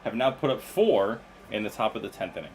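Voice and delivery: excited sports announcer call; play-by-play call. Acted. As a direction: call it like a live broadcast. Reads a game call.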